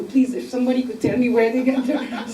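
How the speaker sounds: normal talking voice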